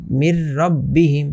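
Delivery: slow মির রব্বিহিম with a doubled র and no nasal; merged without ghunnah. Shown as a demonstration. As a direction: draw out মির রব্বিহিম, the নুন swallowed into র. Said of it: "এইভাবে মুখস্থ করতে হবে".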